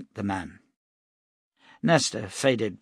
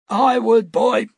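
put-on high, loud old man's call; old prophet's voice; 12 semitones higher, 8 dB louder than usual. Cade answering Nestor from the doorway, old prophet voice. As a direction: cut in from the doorway.

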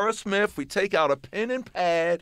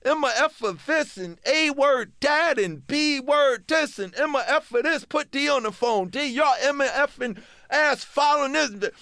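high-pitched imitated tirade; mock screaming rant; 8 semitones higher, 3 dB louder than usual. Manager cursing out the rappers by phone, mock screaming. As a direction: rattle off censored curses in a raised, ranting voice. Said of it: "Russell screaming".

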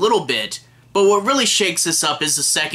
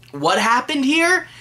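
higher, rising baffled question; puzzled viewer; 8 semitones higher than usual. Acted. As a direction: act out a confused moviegoer, pitch climbing to the end. Acts out puzzled viewer at ending.

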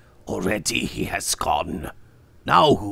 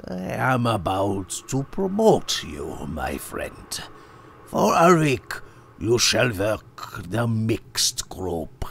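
calm, ending in a slow hushed whisper; placid; 8 semitones higher than usual. Acted. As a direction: placid owner whispering the order. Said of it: "Brandt said placidly".

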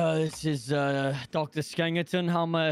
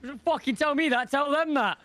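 much higher, raised, frantic begging; desperate plea; 11 semitones higher, slower than usual. Acted. Friend begging staff to save his dying mate, frantic and high. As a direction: yell for help like your mate is dying. Friend pleads for help loudly.

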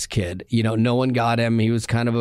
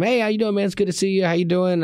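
higher-pitched, upbeat hello; friendly retold greeting; 7 semitones higher, faster than usual. Man greeting a kid at the pizza place, friendly. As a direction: greet him like a pal, pitched up.

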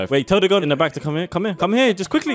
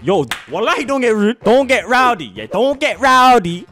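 louder, higher warning, playfully stern; mock telling-off; 9 semitones higher, 6 dB louder than usual. Streamer warning a girl not to get rude, mock stern. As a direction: play at warning someone off, voice raised.

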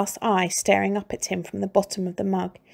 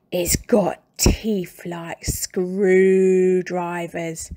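spooky whisper; much slower than usual. A narrator's reading. Mr Twit whispers to scare.

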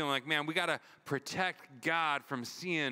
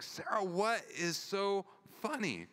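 bewildered question; 6 semitones higher than usual. Retold or imitated. Husband finally asks wife.

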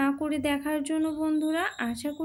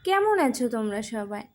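ordinary talk

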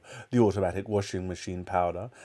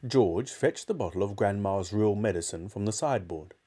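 usual speaking voice

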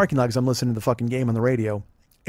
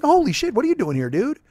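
a higher, startled cry of surprise; mock shock; 10 semitones higher than usual. Imagining running into someone far from home, mock shocked. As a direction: play the friend stunned to see you. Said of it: "you'd be like".

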